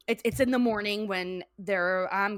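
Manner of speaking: usual speaking voice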